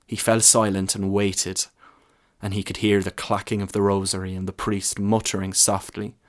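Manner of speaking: ordinary delivery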